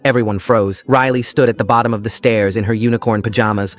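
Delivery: usual speaking voice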